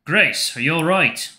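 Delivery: ordinary talk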